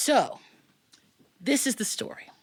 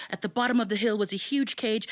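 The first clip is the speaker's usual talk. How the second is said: usual speaking voice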